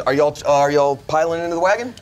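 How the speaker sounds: normal talking voice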